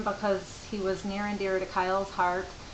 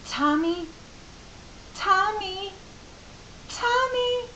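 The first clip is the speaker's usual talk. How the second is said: put-on rising, wailing child's cry of Tommy, climbing higher each time; crying little boy; 15 dB louder than usual. Mother imitates her small son wailing over his toy. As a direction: play a five-year-old sobbing over his broken toy. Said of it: "And he kept crying and crying".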